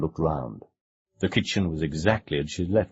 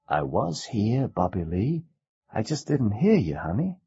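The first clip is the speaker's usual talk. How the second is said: reassuring mother; 6 semitones higher than usual. A narrator's reading. Mother reassures her son.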